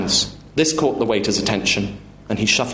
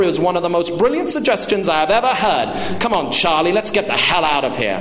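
raised-pitch, overbearing indignation; sarcastic retort; 6 semitones higher than usual. Acted. Father scoffs at waiter and summons son, sarcastic. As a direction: scoff and leave in a huff.

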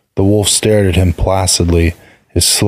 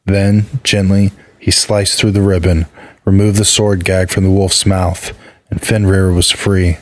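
ordinary delivery, nothing marked

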